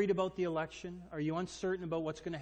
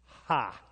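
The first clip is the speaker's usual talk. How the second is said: scoffing laugh; 5 semitones lower, 7 dB louder than usual. Acted. Dad laughing off son's demand.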